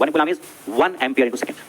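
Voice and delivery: very slow, word by word, "one" leaned on; slow dictation. Shown as a demonstration. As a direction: spell it out slowly for notes. (बहुत धीरे, लिखवाते हुए)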